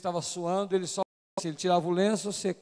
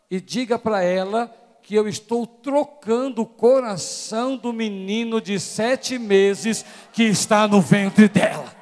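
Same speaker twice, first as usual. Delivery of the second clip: slow, louder solemn proclamation; spirit's message; 8 dB louder, slower than usual. Acted. Preacher voicing the Holy Spirit, slow and louder. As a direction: declare it slowly, with weight. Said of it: "O Espírito Santo falou assim".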